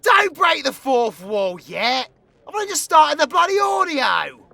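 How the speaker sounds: loud, high mock outburst; hammed-up indignant shout; exasperated scolding. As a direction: snap loudly at them to stop. Scolds listener for breaking fourth wall.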